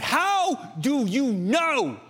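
very slow, high, emphatic demand; shouted, stretched-out question to his boys; dad demanding answer. Retold or imitated. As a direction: draw out every word as a challenge. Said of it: "I said".

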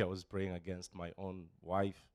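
usual speaking voice

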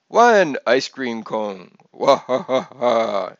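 slow, loud Transylvanian count with measured laugh; theatrical Transylvanian vampire counting; Transylvanian accent. A narrator's reading. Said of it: "in his Transylvanian accent".

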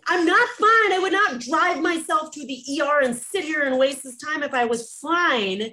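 exasperated protest. Retold or imitated. Her exasperated reply to doctors.

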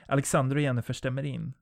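ordinary delivery, nothing marked

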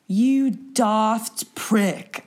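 slow, drawn-out mock British insult; a clumsy stab at a British accent; British accent attempt. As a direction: try a British accent and fail. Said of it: "I can't do the, the accent".

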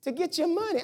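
a hint of a shrugging hustler; weight loss staff admitting the scam, casually; shameless trainer. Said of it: "They say".

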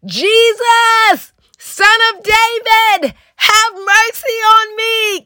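high, drawn-out cry for mercy; shout. A narrator's reading. Preacher reads the blind beggar crying out to Jesus, shouting. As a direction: call it out high and slow, pleading to be heard. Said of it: "he said it with a shout".

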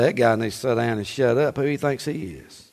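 low, soft grumbling aside; mock critic's mutter; muttered grumble. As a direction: mutter it like a sour church member. Congregation's grumbling voiced.

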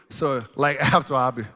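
normal talking voice

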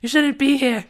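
high, loud urging; urgent warning. A narrator's reading. Niall held hostage warning Liz, urgent cry. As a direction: urge Liz out loudly.

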